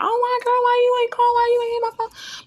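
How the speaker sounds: very high-pitched imitation of a nagging caller; mock complaining friend. Voicing people who complain she never calls.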